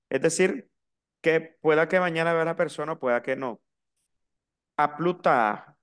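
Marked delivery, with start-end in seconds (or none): 4.8-5.7 "A plus tard" as "a plu taa", no S or D; careful French "A plus tard", S left off "plus"; silent final S. Shown as a demonstration. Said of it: "La S final no se pronuncia".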